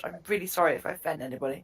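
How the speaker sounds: normal talking voice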